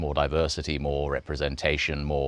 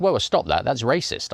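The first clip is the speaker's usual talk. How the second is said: higher, mildly flustered protest; alarmed objection; 5 semitones higher than usual. Retold or imitated. Producer stopping the voiceover artist, mildly alarmed objection. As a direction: cut in to object, pitch rising.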